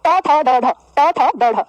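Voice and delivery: ट थ ड ढ slowly, one by one; slow sound drill. Shown as a demonstration. Teacher leading pupils to find tongue position, ट थ ड ढ said slowly. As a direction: curl the tongue and space out ट थ ड ढ. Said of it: "एक बार उच्चारण करो".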